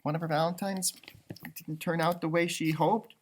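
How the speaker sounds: ordinary delivery, nothing marked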